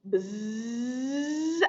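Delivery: soft bee buzz. Acted. Bee buzzing in song.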